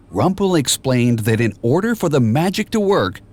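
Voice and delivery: ordinary delivery, nothing marked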